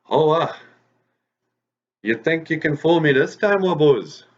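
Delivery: fox's loud challenge. Acted. Fox comes up to rabbit hidden inside tree, challenging. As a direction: call out the rabbit, high and loud.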